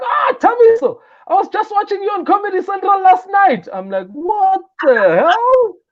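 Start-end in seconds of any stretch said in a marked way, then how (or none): 0.0-3.7 high, bright mock greeting from a famous rapper; friendly celebrity greeting. Rapper greeting a comedian by name, in a high friendly voice. 4.1-5.8 high, slow, drawn-out what the hell; stunned disbelief. Retold or imitated. Comic hearing a famous rapper know him, stunned.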